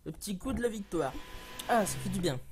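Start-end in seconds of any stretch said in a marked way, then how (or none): none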